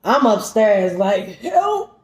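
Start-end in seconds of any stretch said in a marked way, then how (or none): 1.4-2.0 deathbed plea. Retold or imitated. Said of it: "Like, on my deathbed".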